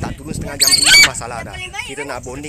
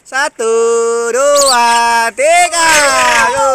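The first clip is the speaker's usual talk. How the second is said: loud countdown; 7 semitones higher, 12 dB louder, much slower than usual. Real, not acted. Counting down the bird release.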